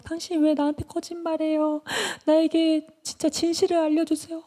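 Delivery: very high, whiny reproach; acted nagging in a put-on high voice; pestering complaint. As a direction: whine like a neglected lover. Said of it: "막 따라다니면서 막 졸랐어요".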